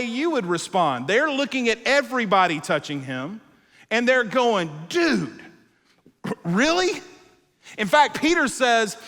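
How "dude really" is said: incredulous dude, really; exasperated disciples. Retold or imitated. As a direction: roll your eyes at him.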